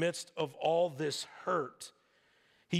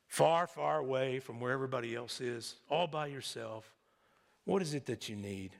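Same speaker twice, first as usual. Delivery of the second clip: gentle asking; 5 semitones lower than usual. Acted. God asking lonely Elijah what he needs, gentle asking. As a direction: ask tenderly, unhurried, voice dropped.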